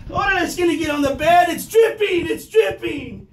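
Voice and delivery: high, loud panicked shout slowing at the end; mock panic. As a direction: yelp in comic alarm. Panicking about the mess.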